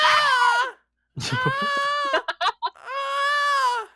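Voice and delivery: very high, loud screeching cat yowl; piercing mock cat shrieks; imitated cat scream. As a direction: scream like a demanding cat, over and over. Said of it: "he starts just screaming".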